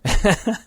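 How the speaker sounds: high burst of laughter; laughing. Real, not acted. Climber admitting his wrong answer, laughing. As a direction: laugh out at your own mistake.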